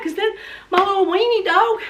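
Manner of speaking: normal talking voice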